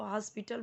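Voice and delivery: हॉस्पिटल as "has-pi-tal"; हॉस्पिटल starting "has", not "hos"; Hindi-style pronunciation. Shown as a demonstration. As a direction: say हॉस्पिटल with an a in the first syllable. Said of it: "normally Hindi उनका language है".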